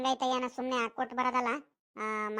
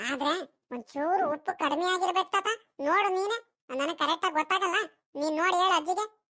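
put-on high-pitched boy voice; child character voice, pitched up; grandson; 4 semitones higher than usual. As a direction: play a boy chatting to his grandpa about food. Grandson tastes the food.

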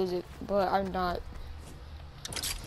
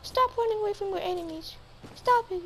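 high squeaky scolding; high-pitched mock taunt; high Elmo-like voice; 8 semitones higher than usual. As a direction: scold him in a puppet voice. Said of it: "Do I sound like Elmo?".

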